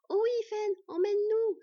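high, loud eager plea; delighted child. A narrator's reading. Twin begs to go travelling, delighted. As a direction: beg with girlish glee. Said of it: "se réjouit Sylvègue".

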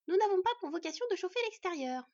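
high, loud dwarf wife; reproachful scolding. A narrator's reading. Wife to husband about the open door, shrill reproach. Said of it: "reprocha la dvergue".

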